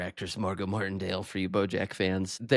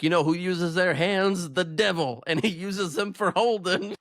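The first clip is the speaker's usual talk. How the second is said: preachy priest; 7 semitones higher than usual. Retold or imitated. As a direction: warn them off sin like a fussy preacher. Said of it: "this priest stands up and he's like".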